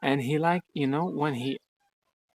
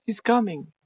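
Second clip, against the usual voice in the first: higher, louder thrilled whisper-free exclaim; delighted the cat is coming; excited; 9 semitones higher, 4 dB louder than usual. Real, not acted.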